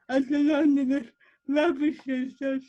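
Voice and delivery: ordinary talk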